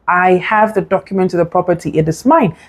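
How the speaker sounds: ordinary delivery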